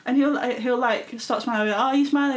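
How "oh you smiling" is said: raised, louder mock tease; louder playful jab; teasing. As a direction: needle him about smiling. Teasing brother who smiles.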